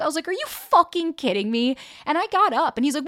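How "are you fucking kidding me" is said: higher, louder, drawn-out disbelief; outraged. Retold or imitated. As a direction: act out being fed up, leaning slowly on each word. Retold quarrel with boyfriend.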